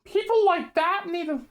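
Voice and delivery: loud exasperated outburst; frustrated rant. Real, not acted. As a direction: vent it, fed up and loud. Exasperated rant about annoying people.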